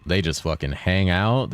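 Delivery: ordinary talk, nothing marked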